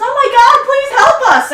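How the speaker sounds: high, loud desperate cry for help; a woman's panicked shout from the cave. A narrator's reading. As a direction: scream it out, begging for rescue. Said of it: "there is a woman who shouts".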